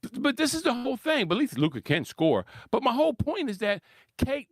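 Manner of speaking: usual speaking voice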